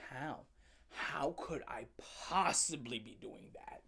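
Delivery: low, hushed exasperated question; hushed disbelief. Retold or imitated. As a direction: drop low and quiet in mock disbelief. Retold incredulous reply, suddenly quiet.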